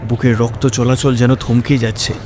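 ordinary delivery

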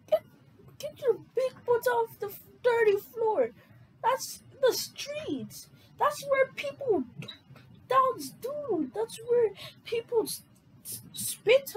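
shrill-pitched tirade, half crying; outraged yelling. Acted. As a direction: scold him off the street floor at the top of your voice. Character yells about dirty floor.

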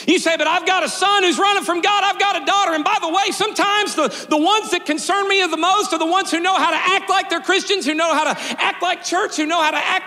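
high, ringing preacher's call; full-force preaching. Acted. Pastor preaching to his congregation about hidden storms, full force. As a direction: lift the room like a revival preacher.

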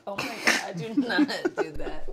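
loud protest through laughter; laughing denial. Acted. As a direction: deny it while cracking up. Laughing denial when teased.

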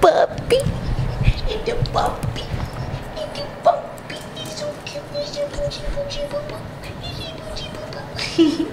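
very high, soft cooing puppy talk. Real, not acted. Cooing at her freshly bathed dog.

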